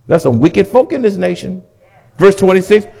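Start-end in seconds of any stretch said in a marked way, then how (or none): none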